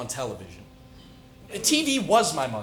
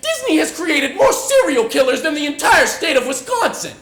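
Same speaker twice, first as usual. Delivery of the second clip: loud, high, manic outburst; unhinged giddy rant; manic excitement; 11 semitones higher, 12 dB louder than usual. Acted.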